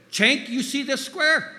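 high, loud, drawn-out challenge; imitated yell. Mentor showing player the scratched square, imitated yell. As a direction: imitate the mentor's in-your-face demand. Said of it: "yelled in his distinctive accent".

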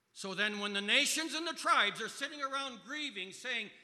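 loud, high fervent preaching; loud fervent pulpit proclamation; preaching at full force. Acted. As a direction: proclaim it to the back row.

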